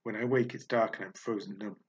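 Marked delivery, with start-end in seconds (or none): none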